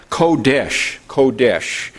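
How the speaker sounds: Kodesh said slowly as KOH-desh, stress on first syllable; teacher demonstrating Kodesh as KOH-desh; Hebrew pronunciation. Shown as a demonstration. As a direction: sound out Kodesh, KOH-desh, twice with care. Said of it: "that's the way it sounds".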